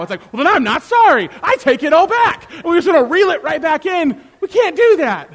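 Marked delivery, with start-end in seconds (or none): 0.3-2.5 loud, high mock outburst; snatch the apology back indignantly; hammed-up offended comeback; acted petulant retort. Acted retort after refused apology. 2.5-5.3 loud, high, exasperated; comic exasperated warning; worked-up exclamation. Acted. Worked up after acted quarrel.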